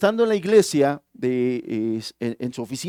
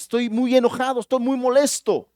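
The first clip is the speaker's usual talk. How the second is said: higher, a bit louder complaint; acted anger; 8 semitones higher, 3 dB louder than usual. Angry man complaining to his pastor, voice raised. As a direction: voice him as upset, pitch pushed up. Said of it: "se siente el hombre muy enojado".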